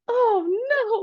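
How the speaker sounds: high, drawn-out mock dismay; dismayed cry. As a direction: wail it in acted alarm. Acting out dismay at toddler's need.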